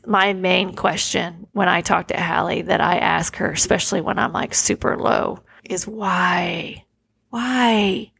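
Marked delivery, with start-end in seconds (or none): 5.7-6.9 hushed, breathless whisper of "why?"; a real, aching whisper on "why?". Real, not acted. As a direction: whisper "why?" as if afraid to ask it aloud. Asking her late daughter why.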